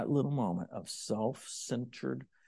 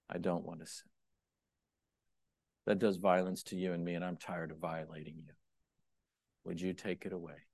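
soft, intimate spoken prayer; demo prayer; 6 semitones lower, 8 dB quieter than usual. Shown as a demonstration. Preacher demonstrating his prayer to God, soft intimate. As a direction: pray quietly and honestly. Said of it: "this way".

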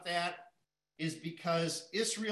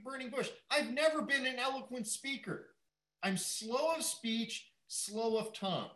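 voicing Moses; 6 semitones higher than usual. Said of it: "what he said in chapter four".

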